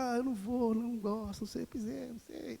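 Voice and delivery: a reluctant person mumbling excuses, fading out; whiny refusal. Retold or imitated. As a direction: sulk and trail off. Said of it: "Eu falo".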